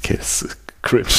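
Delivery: laughing in a whisper. Real, not acted. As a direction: giggle it out under your breath. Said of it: "Du musst dabei flüstern". (flüsternd lachend)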